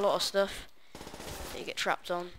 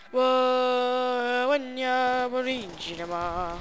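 silly put-on voice; 5 semitones higher, 4 dB louder, much slower than usual.

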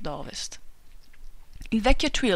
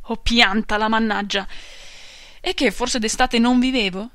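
louder, testy retort; irritated old man; 2 semitones higher, 7 dB louder than usual. Acted. Old father snaps back at son Jacob, loud and testy. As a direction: brush the son off crossly.

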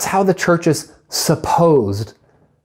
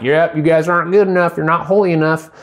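God voiced with a quick southern twang; southern accent; faster than usual. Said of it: "I don't know why God has a southern accent".

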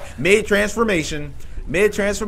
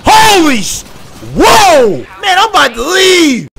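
scared shouting; 9 semitones higher, 6 dB louder, slower than usual. Real, not acted. Monster scare.

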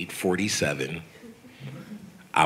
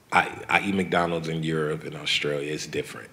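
ordinary delivery

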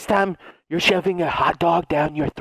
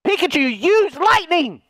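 trainer's command; 11 semitones higher than usual. Acted. Trainer ordering Pikachu to attack, high call.

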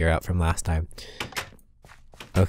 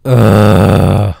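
loud disgusted ugh; frustrated groan; 11 dB louder than usual. Real, not acted. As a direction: groan out your anger. Frustrated at the loss.

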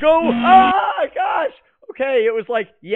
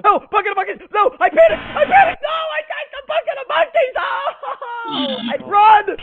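frantic high yelling in panic; panicked screaming; 10 semitones higher, 4 dB louder than usual. Real, not acted. Panic during chase.

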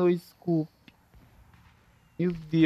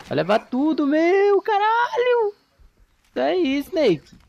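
high, loud hype shouts; excited cheering; 4 dB louder, slower than usual. Real, not acted. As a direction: cheer him on wildly. Cheering a teammate's snipe.

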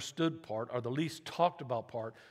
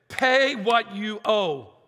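stern demand; 7 semitones higher, 11 dB louder, much slower than usual. Acted. Servant demanding payment in parable.

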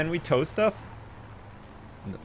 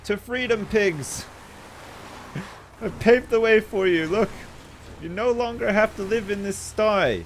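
excited rallying cry; 7 semitones higher than usual. Acted. Player cheering freed pigs on, high excited shouts.

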